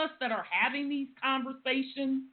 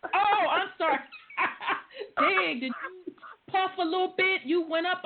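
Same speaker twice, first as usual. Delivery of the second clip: laughing; 8 semitones higher than usual. Real, not acted. Laughing at a friend's slip.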